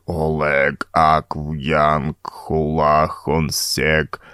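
low, slow, droning monotone; bubbling otherworldly drone; cold bubbling voice. Acted. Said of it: "Шумы трансформировались в холодный булькающий голос, монотонно бубнящий".

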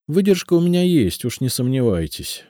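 a hint of a wry smirk, Выдержка leaned on; чуть насмешливо, с гордостью; с усмешкой. A narrator's reading. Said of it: "усмехнулась Елизавета". (с лёгкой усмешкой)

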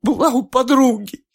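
high, tearful answer; sniffling. A narrator's reading. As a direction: answer between sobs. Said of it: "всхлипывая".

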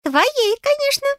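very high, piping little voice; hint eagerly in a squeak; tiny fairy, hungry for payment; greedy fairy. A narrator's reading. Fairy answers impatiently.

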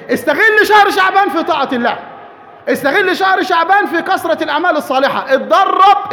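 rally the congregation to action; preaching at full force. Real, not acted.

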